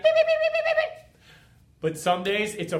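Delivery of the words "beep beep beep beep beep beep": tiny squeaky monkey beeps, far higher; pesky little monkey. Retold or imitated. Small monkey on your back pestering you, squeaky beeps. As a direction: chatter like an annoying lemur on your back. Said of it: "a little lemur monkey just being like".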